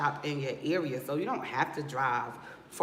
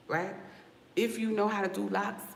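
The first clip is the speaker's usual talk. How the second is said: normal talking voice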